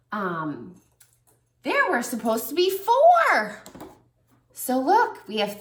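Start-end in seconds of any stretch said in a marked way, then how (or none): 1.6-3.6 four hit high and loud; teacherly emphasis on four; stress on four. Shown as a demonstration. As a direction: make four the point.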